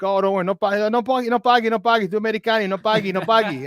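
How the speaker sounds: put-on high, lilting Italian-accented waiter insisting; Italian waiters' voice. Italian waiters refusing the American's money, in a high, lilting Italian accent. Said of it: "and they're like".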